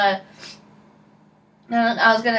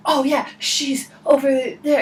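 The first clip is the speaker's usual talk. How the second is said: higher, breathy enthusiastic reply; residual excitement; 4 semitones higher than usual. Retold or imitated. Friend giving directions, misquoted with residual excitement. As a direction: imitate him with too much excitement. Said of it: "like, my residual excitement".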